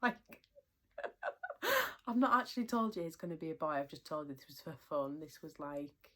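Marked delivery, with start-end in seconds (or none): 1.0-2.0 high burst of laughter. Real, not acted. Laughing at husband's reaction.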